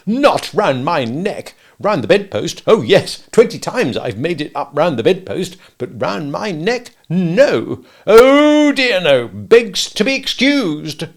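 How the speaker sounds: loud, high, biting sarcasm; mock the tie with furious irony; dangerously sarcastic. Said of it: "He became dangerously sarcastic".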